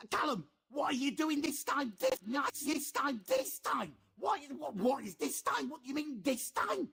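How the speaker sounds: high mocking mum voice; mother's voice. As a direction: squeal it like a scandalised mother. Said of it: "She goes".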